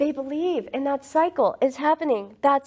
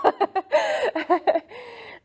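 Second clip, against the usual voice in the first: high burst of laughter; 12 semitones higher than usual. Real, not acted. Laughing at her own summary.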